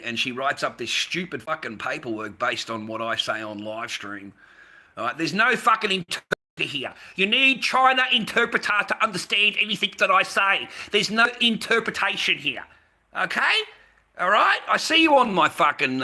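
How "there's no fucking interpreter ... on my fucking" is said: loud, high ranting at a viewer; angry rant. Real, not acted. Ranting at a viewer who needs an interpreter, loud and angry. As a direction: blast the viewer for needing an interpreter.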